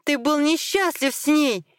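high, heated retort; shouting. A narrator's reading. Wife argues about his former wife, shouting high. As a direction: cry it out, pitched well up. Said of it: "Елена тоже кричала теперь".

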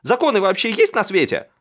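very high, loud indignant cry; outraged screaming. A narrator's reading. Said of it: "и закричала". (возмущённый женский крик)

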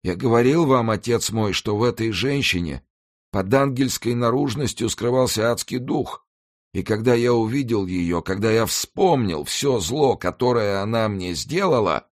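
high, pained pleading voice; anguished dying executioner. Acted. Dying executioner confesses to the monk, high and anguished.

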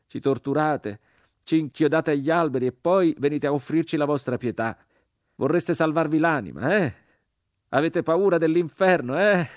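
high, loud, scornful cry; rising to a furious taunt; mocking crucified man. A narrator's reading. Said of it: "gridò l'uomo crocifisso".

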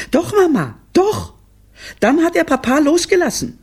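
put-on very high, loud kid voice; excited children. Children answering their mother, high and excited. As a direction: pipe up at the top of the voice.

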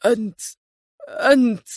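plain reading voice

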